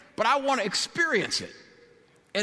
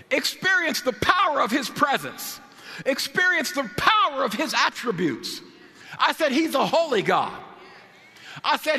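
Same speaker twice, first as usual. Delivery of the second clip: full-force preaching; 6 semitones higher than usual. Real, not acted. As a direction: preach it boldly, building phrase on phrase.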